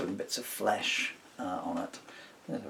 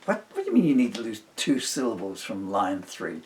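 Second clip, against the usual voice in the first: incredulous retort; 9 dB louder than usual. Retold or imitated. His retort in the argument.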